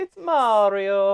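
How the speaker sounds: high, drawn-out video-game Italian voice; hammed-up cartoon Italian catchphrase; Mario. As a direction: squeal it slowly in mock Italian. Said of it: "Haven't you ever heard Mario?".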